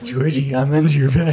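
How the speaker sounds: loud, high spooky taunt; creepy mouse voice. Pretend. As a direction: call out like a creepy creature under the bed. Playing the mouse under the bed.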